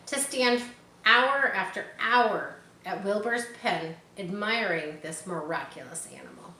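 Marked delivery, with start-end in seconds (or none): none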